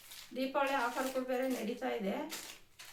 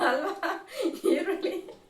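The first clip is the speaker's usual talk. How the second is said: words spilling out through laughter, higher and louder; laughing; 6 semitones higher, 7 dB louder than usual. Real, not acted. Vlogger cracking up at her bad onion joke, laughing while talking. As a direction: laugh your way through the line, tickled by your own joke.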